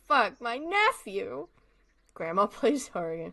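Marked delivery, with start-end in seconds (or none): 0.1-1.5 slow, rising delighted cry; excited. Real, not acted. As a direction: stretch it out happily. Excited recognition.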